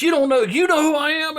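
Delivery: high-pitched mock indignant challenge; retold loud challenge. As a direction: mimic a worked-up stranger, pitch jumping up. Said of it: "he's getting loud in the".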